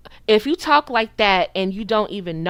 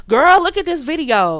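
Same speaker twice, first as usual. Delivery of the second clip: put-on higher, louder call to a girlfriend; mock girlfriend gossip; 6 semitones higher, 5 dB louder than usual. Girls emailing her crazy videos of men, mock excited gossip. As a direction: imitate a girl eager to share a video.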